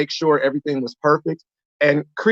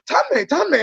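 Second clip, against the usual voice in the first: calling out a name; 9 semitones higher than usual. Retold or imitated. Fans calling out on street.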